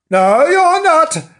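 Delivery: high, loud, slow contradiction; cross rebuff. Acted. Bernard rejecting Frank's apology, cross rebuff. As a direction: refuse it flatly in a huff.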